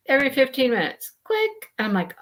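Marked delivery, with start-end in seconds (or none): 1.2-1.7 a sudden high mock click noise. Narrating her stuck upload bar, a high mock click. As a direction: pop the word up high like a tiny click.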